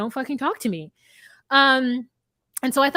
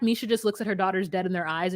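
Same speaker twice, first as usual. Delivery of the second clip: ordinary delivery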